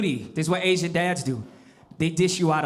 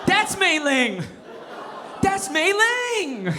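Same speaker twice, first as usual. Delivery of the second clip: high, slow pleading laugh-cry; laughing panicked falsetto; desperate whine; 11 semitones higher, much slower than usual. Retold or imitated. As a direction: stretch it out helplessly, much higher. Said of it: "I'm just up there defenseless".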